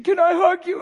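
very high, strained son's plea; son's high broken voice. Retold or imitated. Son at the door pleads with his father, high and strained. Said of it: "He said".

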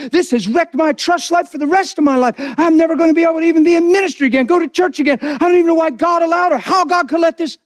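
high, aggrieved churchgoer piling up excuses; mock-wounded rant, pitch climbing as it goes; acted self-justifying complaint. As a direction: act out an offended believer venting. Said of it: "this whole list is people's justification".

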